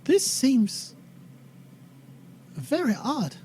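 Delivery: hesitant attempt at Tracy's accent; Tracy's slipping accent. Tracy speaking up to the others, halting, slipping accent. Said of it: "I lost her accent now".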